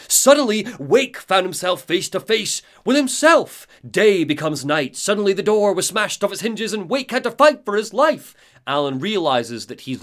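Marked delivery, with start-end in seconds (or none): 0.1-8.3 high, loud, melodramatic narrator voice; leering evil double. Acted. Alan's grinning double narrating his fight, leering and loud. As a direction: declaim it manically with a leering grin. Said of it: "leering, grinning version of Alan who says".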